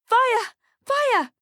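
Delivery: very high, loud alarm cry; screaming. A narrator's reading. Parent by the sleigh raises the alarm, screaming. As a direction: scream a panicked warning. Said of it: "starts screaming".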